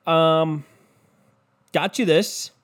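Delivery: high, sweet mock-husband voice; husband presenting a gift. Husband gives his wife an exercise bike, in a high mock-husband voice. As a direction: pitch it up, drawn out, presenting the gift. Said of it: "You're just like".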